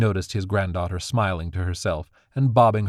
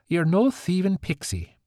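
less jovial; 10 semitones higher than usual. Acted. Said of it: "slightly less jovial voice".

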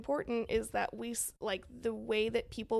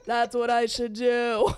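laughing mock Trump; 10 dB louder, slower than usual. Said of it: "Trump ... was like".

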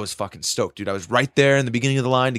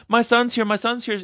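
high-pitched imitation of a protesting mom; retold motherly plea in a high voice; pleading mom; 12 semitones higher than usual. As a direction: mimic a worried mother, pitched well up.

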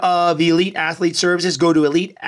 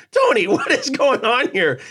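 high-pitched, amused disbelief; retold incredulous question, half laughing; mock bewilderment; 6 semitones higher than usual. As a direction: act out baffled protest, pitched up. Acting out own baffled reaction.

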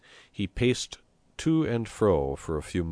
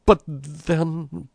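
high, louder whimpering protest; moaning protest; 10 semitones higher, 6 dB louder than usual. A narrator's reading. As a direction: protest with a pitiful moan. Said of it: "moaned Binella".

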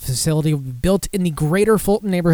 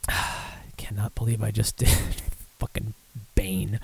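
laughing whisper; 5 semitones lower, 8 dB quieter than usual. Real, not acted. Laughing whisper after the impression.

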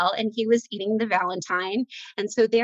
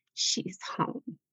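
hushed whisper, much softer; 12 dB quieter than usual. Retold or imitated. Mother recalling her daughter's words, whispered. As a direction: breathe it like a tender secret. Daughter quoted again, tenderly.

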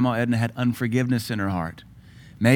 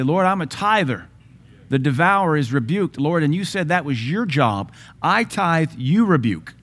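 tithers' prayer; 4 semitones higher than usual. Shown as a demonstration.

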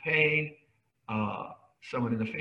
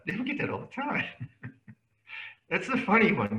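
ordinary talk, nothing marked

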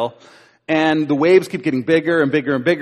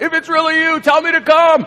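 much higher, louder, eager plea across the waves; reenacted Peter; 13 semitones higher, 5 dB louder than usual. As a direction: call out like a fisherman daring himself. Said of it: "I wanna try to reenact this".

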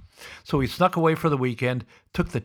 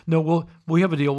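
louder, higher rush of protest; hasty backpedal, raised voice; mock panic; 4 semitones higher, 5 dB louder than usual. Hollywood producers scared of losing the project, mock panic. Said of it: "fear of loss".

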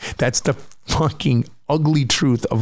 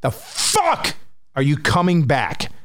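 higher-pitched, frustrated outburst with climbing pitch; exasperated rant at panic; 6 semitones higher than usual. Retold or imitated. Speaker rants at his returning panic disorder, exasperated and higher. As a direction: yell at the panic for coming back. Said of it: "And I'm like".

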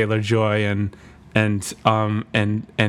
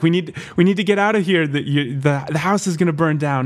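high, fast and urgent; panicked warning; 6 semitones higher, faster than usual. Retold or imitated. Daughter waking her parents as fire nears, high urgent rush. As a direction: rush the words out in alarm. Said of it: "wakes her parents and is like".

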